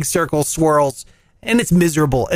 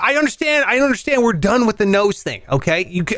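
ordinary delivery

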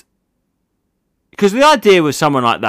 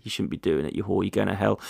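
mock moralising; 4 semitones lower, 10 dB quieter than usual.

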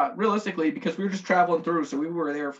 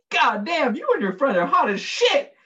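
higher, louder, laughing come-on to two passing women; laughing flirt; 8 semitones higher, 4 dB louder than usual.

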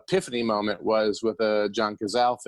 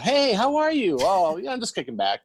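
high, breezy mock-casual greeting; faking okay; 10 semitones higher than usual. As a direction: act carefree as if nothing is wrong. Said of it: "act like everything is okay".

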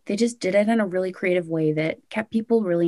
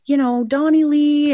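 high, excited gushing at each name; excited squeal; 10 semitones higher, 4 dB louder than usual. Retold or imitated. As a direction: squeal over friends' names on screen. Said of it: "We're just like".

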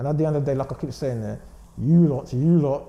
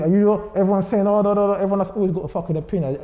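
heated outburst; 5 semitones higher, 4 dB louder than usual. Real, not acted. Heated rant about critics.

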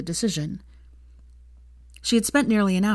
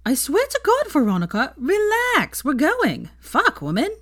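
put-on high, loud, exasperated girl's voice; exasperated friend; 10 semitones higher, 4 dB louder, slower than usual. A narrator's reading. Friend leaving the common room, exasperated and loud. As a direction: throw up your hands and shout it.

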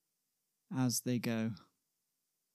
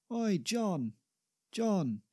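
high, drawn-out hail; calling out; 8 semitones higher, slower than usual. Acted. Shakespeare spots John by the Thames and calls out to him. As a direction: call to someone a little way off, pitched up.

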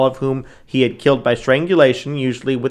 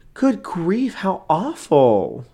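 mock prim shock, pitched up then drawn out slowly; a lightly voiced Nilsen, feigning dismay; put-on prim horror, stretched slowly; Nilsen imitation; 5 semitones higher, much slower than usual. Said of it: "That's only, like, how I imitate him".

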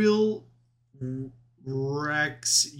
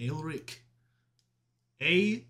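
put-on a light Scottish accent on Elric, said softer; 5 dB quieter, much slower than usual. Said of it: "Scottish accent".

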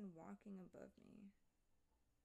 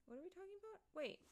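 confused; 10 semitones higher, much faster than usual. Real, not acted. Confused by lost thread.

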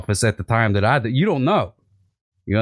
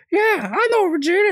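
squeaky party guest. Retold or imitated.

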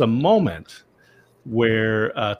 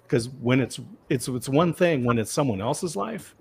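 ordinary delivery

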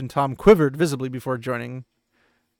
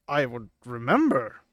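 stiffly. Retold or imitated. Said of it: "kind of stiffly".

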